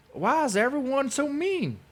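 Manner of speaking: high whiny mocking cry; mock crybaby voice. Mocking him as a crybaby, high whiny voice. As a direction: whimper it like a spoiled child. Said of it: "you sit back and cry".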